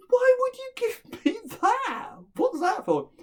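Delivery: very high, loud protest; acted indignation. Son complains to man on the road, high indignant protest. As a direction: squeal it in outraged disbelief.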